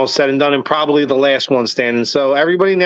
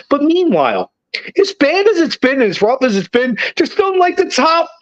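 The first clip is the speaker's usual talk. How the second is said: pitched way up, exasperated and fired up; worked-up rant; 9 semitones higher than usual. Real, not acted. Podcaster ranting at fans who want to sell the team, high and exasperated. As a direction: push back hard, voice climbing high.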